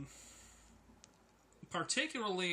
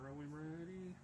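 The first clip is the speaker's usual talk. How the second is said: third grade teacher; 11 dB quieter than usual. Retold or imitated. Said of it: "My third grade teacher".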